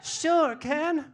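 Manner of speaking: very high doll voice; Barbie doll. Acted. Barbie agreeing to go party with Ken, high doll voice. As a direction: answer in a high-pitched doll voice. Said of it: "Come on, Barbie".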